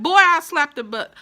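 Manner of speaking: mock-threaten the nephew like an old uncle; imitating uncle. Uncle scolding his nephew, lightly voiced mock threat. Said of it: "the uncle would be like".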